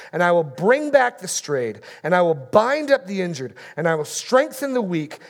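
loud, ringing pulpit reading; booming sermon scripture reading; preaching at full force. Acted. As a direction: thunder out the prophet's rebuke. Reads God's promise aloud.

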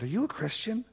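partygoer's question. Retold or imitated. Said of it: "and they said".

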